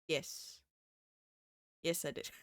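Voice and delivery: ordinary talk, nothing marked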